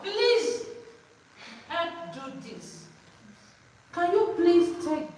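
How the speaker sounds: Please high and loud, then slow; polite request demo. Shown as a demonstration. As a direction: model the magic word Please, drawn out and emphatic. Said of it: "use that word".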